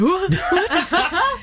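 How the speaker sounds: high, rising double question with a laugh; dad's surprise. Retold or imitated. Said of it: "he's like".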